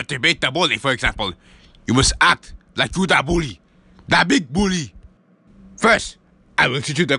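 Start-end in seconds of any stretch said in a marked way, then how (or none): none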